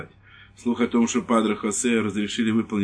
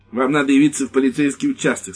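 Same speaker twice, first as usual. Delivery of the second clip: stern lieutenant; 5 semitones higher, 6 dB louder than usual. Acted. Lieutenant orders the priest.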